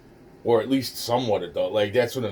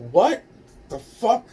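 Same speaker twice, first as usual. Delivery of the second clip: high, loud, incredulous outburst; shocked disbelief; 13 semitones higher, 6 dB louder than usual. Retold or imitated. Reacting to what they were gonna do, shocked. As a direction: act out being stunned, pitch leaping up.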